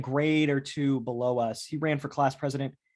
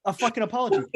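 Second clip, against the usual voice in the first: laughing demand; 8 semitones higher, 5 dB louder than usual. Real, not acted.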